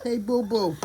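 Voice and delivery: put-on light New York accent; a lazy take on a New Yorker; a hint of New York accent; mock New York accent. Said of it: "That is not New York".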